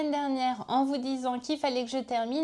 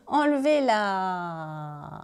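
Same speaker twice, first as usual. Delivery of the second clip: very slow, drawn-out "enlevé la"; 3 semitones lower, much slower than usual. Real, not acted. S'étire lentement sur "enlevé la" en riant. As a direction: stretch "enlevé la" out slowly. Drags the word after laughing.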